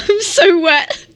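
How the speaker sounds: real laugh, slow, breaking into the words. Real, not acted. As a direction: laugh it off after a slip. Laughs at her own misread.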